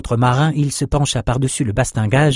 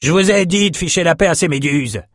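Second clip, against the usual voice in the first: roared captain's order; roaring; 6 semitones higher than usual. Acted. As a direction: roar at the crew to stop. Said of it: "rugit le capitaine Eli".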